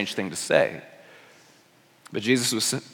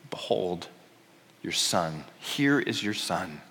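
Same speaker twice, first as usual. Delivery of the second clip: low, soft and unhurried; tender solemn quote; 5 semitones lower, 10 dB quieter, slower than usual. Retold or imitated. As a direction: speak gently, as to a grieving mother. Jesus speaking tenderly to Mary.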